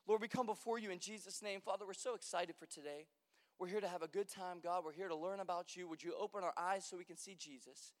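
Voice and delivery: soft, low prayer voice. Real, not acted. Pastor praying with the congregation, softly. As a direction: pray quietly over the room.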